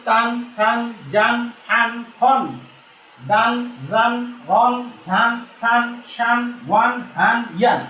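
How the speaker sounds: teacher leading a recitation drill. Shown as a demonstration. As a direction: chant each syllable for the class to repeat. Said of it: "যেমন আমরা পড়লাম".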